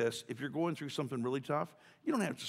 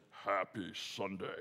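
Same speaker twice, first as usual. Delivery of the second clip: God-sounding voice; 5 semitones lower, 6 dB quieter, slower than usual. Retold or imitated. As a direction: boom it out like the voice of God. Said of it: "in his God-sounding voice".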